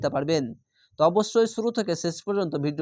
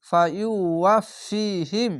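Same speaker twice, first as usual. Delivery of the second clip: Quranic recitation; 2 semitones higher, much slower than usual. Shown as a demonstration. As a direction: chant the word slowly, as in tilawat. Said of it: "এক আলিফ টেনে পড়বেন".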